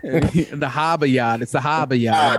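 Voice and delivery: slow, dropped-r Boston hahbah yahd; Boston accent. Retold or imitated. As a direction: lay on the Boston accent, slowly. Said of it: "Throw that New England accent into pop".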